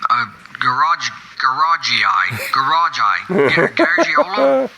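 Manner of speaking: garage, garagei, garageiolo? sounded out slowly, syllable by syllable, as if unsure; stumbling pronunciation. Real, not acted. Caller stumbling over garageiolo? to the hosts, slow and unsure. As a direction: drag out garageiolo? while groping for the word. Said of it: "I gotta look that one up".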